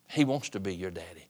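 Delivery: a tender hushed promise. Shown as a demonstration. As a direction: drop low and soft on "He wants to be your Daddy". Preacher softens on repeated line.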